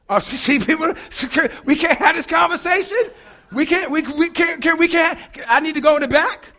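high, loud playful protest at the crowd; mock indignant. Real, not acted. Preacher teasing a silent congregation, mock offended and loud.

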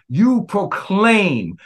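ordinary talk

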